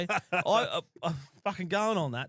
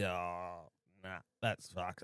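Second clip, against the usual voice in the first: disgusted; 9 semitones lower, 10 dB quieter, much slower than usual. Retold or imitated. Uncle disgusted by Scottish food.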